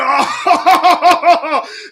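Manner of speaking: loud, high madman's cackle; mad laughter. Acted. Saul gone mad over Samuel, laughing wildly. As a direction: laugh aloud like a crazed king. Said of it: "he laughed aloud".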